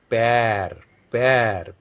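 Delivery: "pear" with a long, open flattened eh; flattened vowel. Shown as a demonstration. French teacher showing the word for father, flattened vowel. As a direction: spread the lips through the vowel of "pear". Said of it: "that is more flattened".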